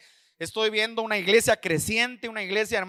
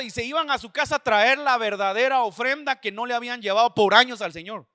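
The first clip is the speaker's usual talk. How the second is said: ordinary talk, nothing marked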